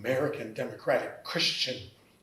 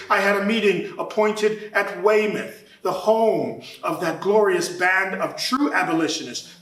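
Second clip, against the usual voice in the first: ordinary talk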